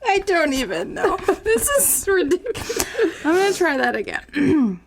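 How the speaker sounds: giggling through the words; can't stop laughing while speaking. Real, not acted. Cracking up while trying to read.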